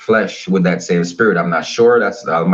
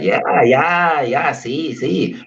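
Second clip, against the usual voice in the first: tag retold Mexican man; 5 semitones higher, much slower than usual. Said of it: "He's like".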